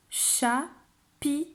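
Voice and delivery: "Chapiteau" split cha, pi, teau with pauses; syllable by syllable. Shown as a demonstration. Teacher showing a child how to count syllables, word split up. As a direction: count out "Chapiteau" slowly in three separate beats. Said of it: "Tu vas compter les syllabes de chapiteau".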